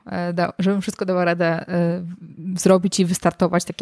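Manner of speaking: usual speaking voice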